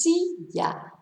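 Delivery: "silla" stressed on the first syllable, SI-lla; natural stress. Shown as a demonstration. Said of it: "Their stress is in the correct place".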